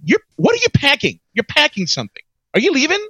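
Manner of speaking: high-pitched mock alarm; playfully startled, pitch jumping up; teasing a cohost who seems to be packing up; surprised teasing. Real, not acted.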